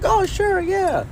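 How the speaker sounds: very high-pitched mock mom voice; imitating mom. Mom agreeing to a sleepover, imitated in a high voice. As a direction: pipe up as an agreeable mother. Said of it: "my mom was like".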